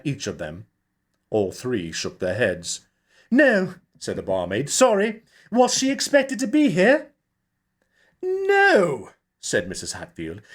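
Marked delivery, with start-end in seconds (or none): 3.3-3.7 put-on high, louder young barmaid. A narrator's reading. Barmaid answering the director, high and loud. 4.7-7.1 put-on high, louder young barmaid. A narrator's reading. Barmaid answering the director, high and loud. 8.2-9.1 very high, louder fussy woman; fretful director. A narrator's reading. Mrs Hatfield answering the barmaid, high and fretful.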